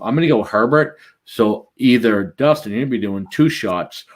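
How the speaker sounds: usual speaking voice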